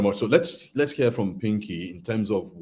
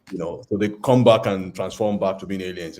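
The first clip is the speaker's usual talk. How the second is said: normal talking voice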